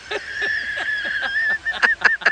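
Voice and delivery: high-pitched laugh; laughing at the joke. Real, not acted. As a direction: break into laughter. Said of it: "teller laughs".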